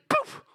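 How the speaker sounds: high squeaky poof; cartoon birth pop; poof sound. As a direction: pop it out like a piglet. Piglet shooting out of pig.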